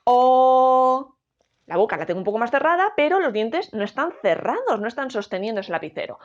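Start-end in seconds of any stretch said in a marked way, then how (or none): 0.0-1.2 a loud, open O that lets the pencil drop; open vowel O. Shown as a demonstration. Teacher showing students the pencil falling on O. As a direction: throw the O out with the jaw open. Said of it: "la O es una vocal abierta".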